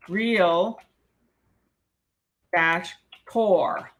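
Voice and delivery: real and tor split by a pause; two syllables. Shown as a demonstration. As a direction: say real, then tor, apart. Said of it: "How many knew that it was".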